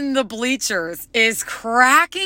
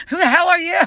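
put-on toddler's retort; 8 semitones higher, much faster than usual. Toddler voiced rejecting stranger.

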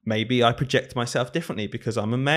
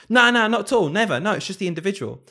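much higher, louder, hammed-up protest; mock defensive denial; 8 semitones higher, 4 dB louder than usual. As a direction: overplay the flat denial, shrill with disbelief. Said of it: "as to say".

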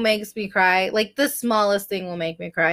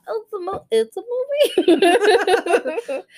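"movie" pitched very high, laughing; exaggerated questioning tune; 10 semitones higher, slower than usual. Real, not acted. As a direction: squeak "movie" up like a doubtful question. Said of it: "Question mark?".

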